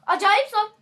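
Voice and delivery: high, loud outburst on "Acayip zor"; emphatic shout. Real, not acted. Repeats it as a shout.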